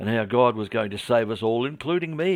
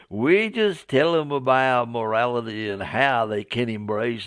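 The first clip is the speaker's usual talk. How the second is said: hammed-up Southern drawl, pitched up; Southern American accent; 3 semitones higher, slower than usual. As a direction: drag the vowels like a folksy Southern evangelist. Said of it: "my poor attempt at a Southern American accent".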